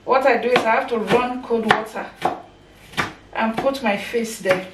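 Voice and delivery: normal talking voice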